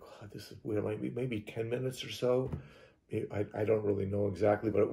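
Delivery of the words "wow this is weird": very soft inner musing; hushed aside as a thought; silent thought voiced. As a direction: say it under your breath, to yourself. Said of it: "I'm just thinking this and observing it".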